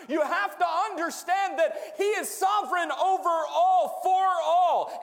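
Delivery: slow, loud, high preaching climax. Acted. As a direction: build it up like a revival preacher. Preaching at full force.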